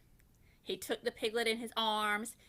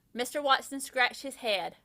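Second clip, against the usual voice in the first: ordinary delivery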